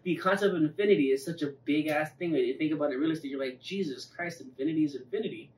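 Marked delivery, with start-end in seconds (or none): none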